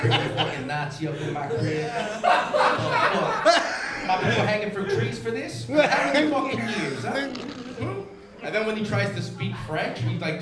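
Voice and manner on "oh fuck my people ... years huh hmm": high, loud hammed-up indignation; mock outrage. Comic acting outraged about the Speaker, hammed-up high rant. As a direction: rant like your people were wronged.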